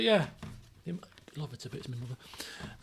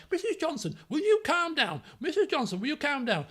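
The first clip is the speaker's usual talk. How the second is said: head of year pleading; 8 semitones higher than usual. Retold or imitated. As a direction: try to calm a furious parent. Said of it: "was like".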